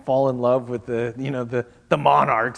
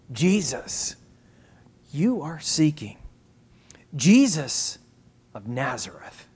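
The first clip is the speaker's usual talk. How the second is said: each "Jesus" set apart by pauses; stress on Jesus; slower than usual. Shown as a demonstration. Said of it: "The emphasis is on Jesus".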